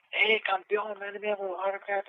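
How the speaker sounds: imitated eager fan. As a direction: mimic a fan calling to the champ. Voices a fan hailing the champion.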